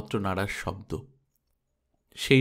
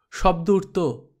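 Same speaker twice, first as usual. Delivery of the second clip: plain reading voice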